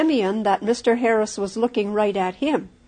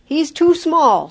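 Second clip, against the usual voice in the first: loud, higher, slow angry protest; storming; 6 semitones higher, 6 dB louder than usual. A narrator's reading. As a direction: storm at them in disbelief. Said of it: "stormed Mister Harris".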